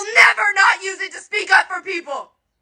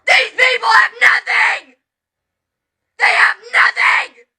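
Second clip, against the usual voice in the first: slow, louder, crying shout; real anguished anger; 3 dB louder than usual. Real, not acted. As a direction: hammer it home through tears. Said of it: "I am angry".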